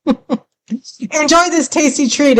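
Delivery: high, slow, giggling delivery; laughing through it. Real, not acted. Host laughs at herself while introducing the track. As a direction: laugh your way through the line.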